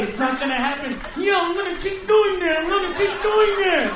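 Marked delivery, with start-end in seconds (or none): 1.2-2.6 defiant. Real, not acted. 2.6-3.9 defiant. Real, not acted.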